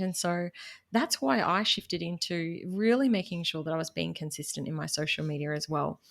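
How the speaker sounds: usual speaking voice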